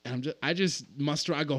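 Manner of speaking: ordinary talk, nothing marked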